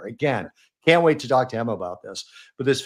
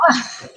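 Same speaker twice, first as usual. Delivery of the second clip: sudden high laugh; burst of laughter; 13 semitones higher, 4 dB louder than usual. Real, not acted. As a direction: break into a laugh. Laughing at slang definition.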